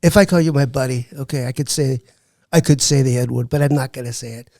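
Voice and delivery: impersonated politician holding forth; Donald Trump. Acted. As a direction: play the president on a comedy show.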